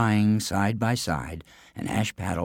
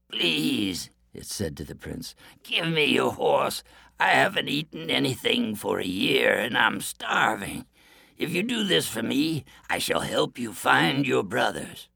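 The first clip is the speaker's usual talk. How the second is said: starving wolf; 5 semitones higher than usual. Acted. Wolf speaks to prince.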